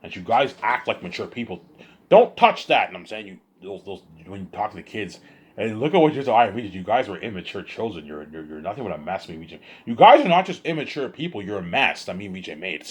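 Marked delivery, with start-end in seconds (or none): 2.1-2.9 snapped out loud and high, cutting off the rant; sudden sharp warning. Real, not acted. Speaker warns someone off camera, loud sharp command. 9.8-11.4 loud, high-pitched burst of anger; angry outburst. Real, not acted. Speaker lashes out at adult viewers, loud angry yell. Ranting at his audience.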